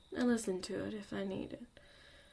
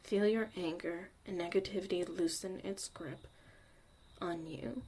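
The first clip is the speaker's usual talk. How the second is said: guided meditation. Acted. Leads a breathing meditation.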